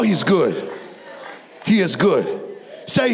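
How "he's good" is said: ordinary delivery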